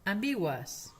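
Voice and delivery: "ambiguas" ending in u plus schwa and S. Shown as a demonstration. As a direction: say "ambiguas" with a gliding uas ending. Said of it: "es ua".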